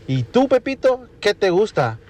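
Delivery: teacher voice. Acted. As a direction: play the schoolteacher asking high and sweet. Teacher asks Pepito in joke.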